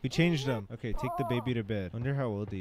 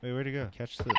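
ordinary talk, nothing marked

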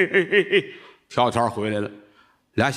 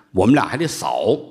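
ordinary talk, nothing marked

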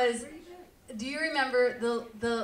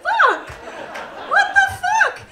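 high, loud laughing disbelief; laughing outrage; 11 dB louder than usual. Real, not acted. Comic shrieking at the lullaby's ending, laughing outrage.

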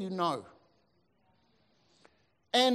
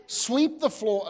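"Sweep the floor" slowed and weighted, each word laid down; slow stressed order; 4 semitones higher, slower than usual. Retold or imitated. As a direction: hand down "Sweep the floor" slowly like a firm master. Master's order repeated for emphasis.

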